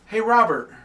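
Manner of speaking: imitating Zach. Retelling a voice heard in the basement, imitated call. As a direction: call out a name in a friend's voice. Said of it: "All I heard was in Zach's voice".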